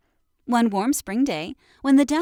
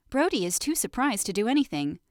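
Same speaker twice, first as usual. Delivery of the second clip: plain reading voice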